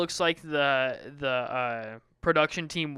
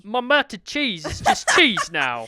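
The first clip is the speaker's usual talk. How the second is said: slow, raised, loud British lament; mock British accent; 5 semitones higher, 6 dB louder, slower than usual. Joining in as the sandwich guy, slow mock British accent. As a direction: stretch it out in exaggerated British. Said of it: "making fun of accents".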